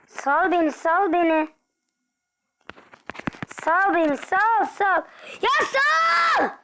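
plead, then explode; building to a shout. Real, not acted. Begging the enemy to let go, building to a shout.

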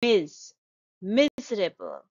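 stressed "Mis-" alone, then "miserable"; first-syllable stress. Shown as a demonstration. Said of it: "You will stress the first sylla".

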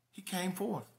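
ordinary delivery

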